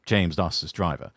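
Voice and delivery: plain reading voice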